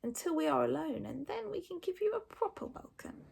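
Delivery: silky, sinister creature voice; Hook Fang purring menace. A narrator's reading. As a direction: purr the threat sweetly and softly. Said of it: "purred Hook Fang menacingly".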